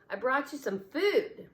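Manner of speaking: proudly serve the toys; playful announcing. A narrator's reading. Boy playing host to toys.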